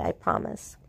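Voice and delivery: breathy whispered I promise; soft whisper of a promise to a child. Retold or imitated. Whispering a promise to the little boy who wants a mommy.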